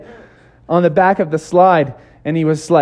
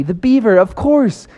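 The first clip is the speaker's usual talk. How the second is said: usual speaking voice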